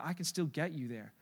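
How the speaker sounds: tender reassurance; reassuring. Pretend. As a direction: quietly comfort the listener. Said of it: "God's saying".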